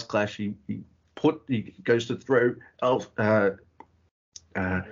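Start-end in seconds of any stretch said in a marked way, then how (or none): none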